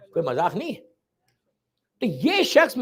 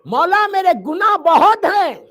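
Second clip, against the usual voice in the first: loud, high, slow despairing cry; anguished plea; 9 semitones higher, 11 dB louder than usual. Acted. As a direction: cry out that the sins are too many. Sinner's plea repeated.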